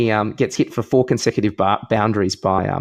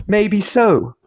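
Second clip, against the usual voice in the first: higher-pitched retort, lightly played; lightly voiced Orman; 6 semitones higher than usual. Batsman answering a sledge about being too shit, lightly acted retort. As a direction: deliver it as a comeback zinger, pitched up. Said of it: "I like the d-, delivery on that one".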